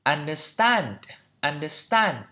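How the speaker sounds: understand with a British open "a" in the last syllable; British pronunciation. Shown as a demonstration. Teacher showing learners the British version, British accent on understand. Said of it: "trong anh Anh nó là".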